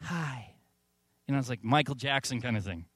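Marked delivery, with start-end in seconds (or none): 0.0-0.5 much softer, delicate hi; soft hi from a burly folk singer; tiny soft hi. Retold or imitated. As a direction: say hi gently as a huge man surprisingly soft. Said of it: "he's like".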